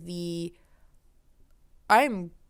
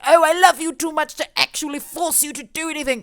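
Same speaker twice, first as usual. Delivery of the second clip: doting father; 7 semitones higher, 4 dB louder than usual. Retold or imitated. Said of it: "he'd be like".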